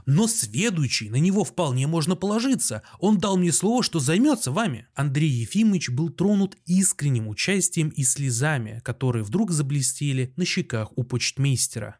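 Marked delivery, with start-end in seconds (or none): none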